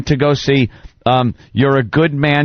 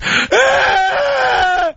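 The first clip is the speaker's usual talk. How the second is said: mock childish wail. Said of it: "and I'm just".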